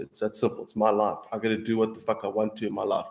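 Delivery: ordinary talk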